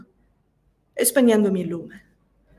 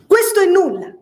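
high, bright exclamation, louder and much higher; exclaimed; 9 semitones higher, 4 dB louder than usual. A narrator's reading. Catherine brushing off Nelly's objection, loud exclamation. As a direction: fling it out with a laugh. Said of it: "gridò".

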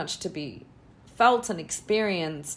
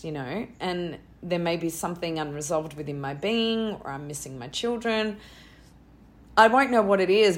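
ordinary talk, nothing marked

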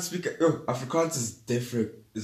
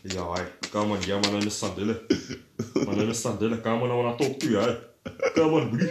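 lightly put-on Afrikaans-accented bro; faster than usual. Teasing co-host by imagining him introducing himself, mock Afrikaans accent. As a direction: imitate an engine-chested guy with an Afrikaans accent. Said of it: "sound like they have a motorbike engine in their chest".

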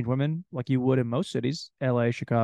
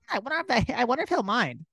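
high, hammed-up musing woman; mock a nosy woman's chatter; higher lightly mocking female voice; 8 semitones higher, faster than usual. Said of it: "They just wake up like".

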